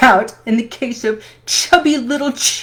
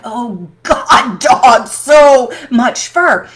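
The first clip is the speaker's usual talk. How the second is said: exasperated; 6 dB louder, much slower than usual. Retold or imitated. Exasperated at dog fur.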